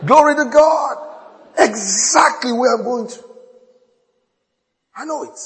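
loud, high preacher's proclamation; preaching at full force. Acted. Pastor proclaiming to his church, loud and high. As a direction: preach it out to the congregation.

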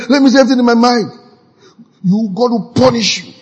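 fast, high and loud rush; mock rant. Frustrated jobless man ranting at the governor, fast and loud. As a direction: blurt it all out fast.

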